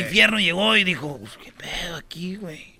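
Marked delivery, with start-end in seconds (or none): 1.2-2.8 whispering. Acted. Character arrives in dark hell.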